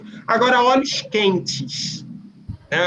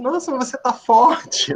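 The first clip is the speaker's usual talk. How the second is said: higher, slow, sugary fake compliment; mock polite compliment; 5 semitones higher than usual. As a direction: soften an insult into flattery. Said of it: "Aí fala assim". (elogio falso e meloso, mais agudo e lento)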